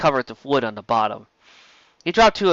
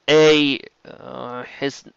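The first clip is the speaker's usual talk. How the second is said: ordinary delivery, nothing marked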